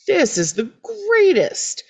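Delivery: high-pitched mock-thrilled gushing; super excited. Megan delighted that Felicity seems insane, super excited. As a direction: gush with delight, hammed up. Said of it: "she's super excited about it".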